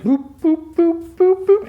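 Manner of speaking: high-pitched electronic boops; short high boops mimicking a countdown; imitated timer beeps. Podcaster imitates the timer on his chest, high mechanical beeping.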